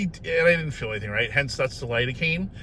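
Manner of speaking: ordinary delivery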